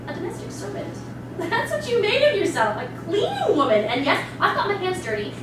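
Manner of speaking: high, laughing scorn at the servant; mocking laugh. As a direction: laugh down at her choices.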